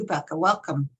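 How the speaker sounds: ordinary delivery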